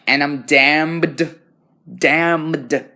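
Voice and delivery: DAMNED sung high and loud, drawn out with a clear D; clear diction. Shown as a demonstration. Student fixing muddy diction on "damned", over-clear and sung. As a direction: punch out both ends of "damned". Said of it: "So I need to be more like".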